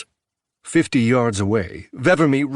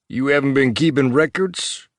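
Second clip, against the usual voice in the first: stern officer voice; 3 semitones higher than usual. Acted. Vevermy questions Marv.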